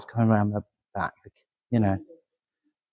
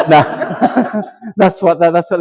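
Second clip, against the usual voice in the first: laughing; 8 semitones higher, 6 dB louder, slower than usual. Real, not acted. Medium laughing at spirit message.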